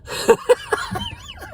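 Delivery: squeaky giggle breaking out; high squealing laugh. Real, not acted. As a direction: laugh high at the absurd moment. Laughing retelling the mayhem.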